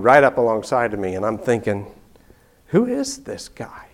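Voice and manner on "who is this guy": alarmed thought. Retold or imitated.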